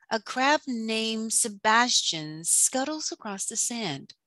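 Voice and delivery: ordinary delivery, nothing marked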